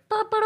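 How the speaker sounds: high-pitched mock bugle noise; imitated horn call. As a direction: sound a high call to arms. Mimics swarm summoned by reply.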